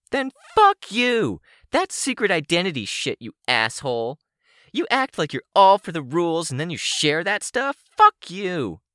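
high-pitched, accusing villainess; high, indignant character voice; angry villain woman. Acted. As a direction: hurl accusations in a high, hostile voice. Sidepiece rages at Rain.

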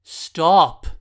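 loud, high shouted stop. A narrator's reading. Jonathan yells at the kid, loud and high. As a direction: bark it out to halt him. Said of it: "Jonathan raised his voice".

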